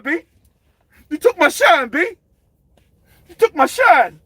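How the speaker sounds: hammed-up angry heavyweight; Miller impression. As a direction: spit it out, jealous and bitter. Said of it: "Then he started talking shit".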